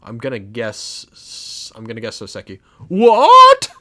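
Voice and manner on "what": very loud, high rising shout; huge high-pitched outburst of shock; shocked yell. Acted. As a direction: explode in disbelief.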